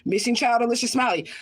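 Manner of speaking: normal talking voice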